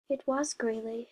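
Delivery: usual speaking voice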